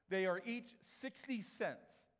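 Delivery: each sixty cents said slowly, every word weighted; slow emphatic statement. Shown as a demonstration. Preacher stressing the price to the congregation, slow and weighted. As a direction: lay it out slow so it sinks in. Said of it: "that's important".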